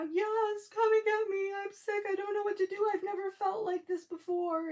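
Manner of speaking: high-pitched, pleading mock wail; imitated bawling daughter. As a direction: voice a sick daughter begging to be fetched. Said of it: "she could hardly talk".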